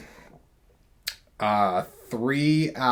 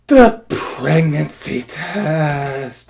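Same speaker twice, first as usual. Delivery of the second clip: quoted line; 5 dB louder, much slower than usual. Retold or imitated. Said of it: "Goes like this".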